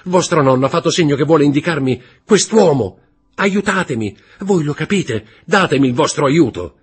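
high, loud urgent begging; loud, high-pitched desperate appeal; frantic plea. A narrator's reading. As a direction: press her to help, breathless with hope. Franz begs Valentine for help.

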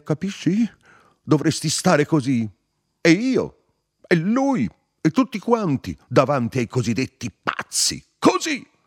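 loud, very high-pitched rant; impassioned shouting. A narrator's reading. Narrator kneels and harangues the two men, shouting. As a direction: cry out each phrase to a final yell.

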